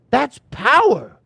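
preacher hammering the point home; emphatic punchline. Acted. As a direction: land "That's power" slow and heavy.

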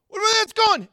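high, loud startled cry; alarmed yell. Retold or imitated. Man cries out as the car heads for the cliff, alarmed yell. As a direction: cry out in disbelief. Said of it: "And he's like".

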